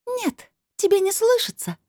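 very high, ringing disembodied voice; crystal-bell voice. A narrator's reading. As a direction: answer in a tiny crystal-bell pitch. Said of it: "словно тысячи хрустальных колокольчиков". (очень высокий хрустальный голос)

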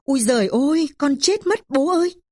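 very high acted wail; high, drawn-up wailing plea; shrieking cry. A narrator's reading. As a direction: cry out in pain to the father. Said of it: "khóc rít lên".

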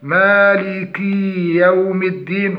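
مالك with final i stretched into a long ee; overlong kasra. Shown as a demonstration. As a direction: exaggerate the kee in مالك, as a mistake. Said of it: "المبالغة في كسرة الكاف". (مالك بإشباع الكسرة حتى تصبح ياء)

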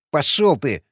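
ordinary delivery

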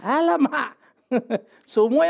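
hammed-up sulky protest, pitched high; mock whiny protest. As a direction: groan it like a spoiled patient.

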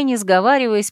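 usual speaking voice

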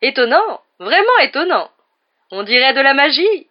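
high, loud, wide-swooping wonder; amazed exclamation. A narrator's reading. Lockhart flies up the pipe behind Fumsec, exclaiming in amazement. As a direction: marvel at the top of your voice. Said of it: "Lockhart qui s'exclamait".

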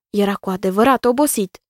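plain reading voice

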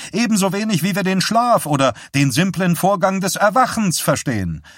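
forceful speech to a press conference; doctor addressing press. Acted. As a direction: lecture the reporters with rising insistence.

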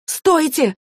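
high, loud cry to stop; shouting. Acted. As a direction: shout out to halt them, voice leaping up. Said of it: "закричала Клэри". (высокий громкий крик)

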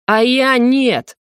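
ревнивый рык, высоко и медленно; огрызнись сопернику, растягивая слова; Сандр. Acted. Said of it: "ревниво прорычал Сандр". (ревнивое рычание)

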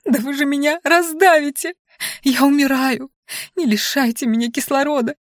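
high, stretched-out wail for help; panicked squealing. A narrator's reading. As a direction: wail like a tiny creature being crushed. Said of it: "верещала Анфиса".

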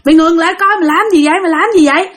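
mildly urgent calling after someone. Retold or imitated. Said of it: "rượt theo phía sau kêu".

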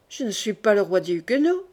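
ordinary delivery

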